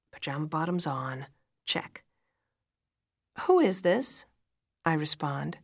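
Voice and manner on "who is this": higher, louder questioning; sharply questioning lift in the voice. A narrator's reading. Woman answering an unexpected phone call, questioning.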